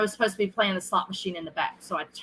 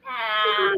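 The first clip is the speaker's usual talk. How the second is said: yelp; 14 semitones higher than usual. Retold or imitated. Retold reaction to shady locations.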